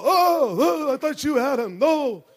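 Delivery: put-on loud, high panicky Yiddish accent; frantic parent in a Yiddish accent.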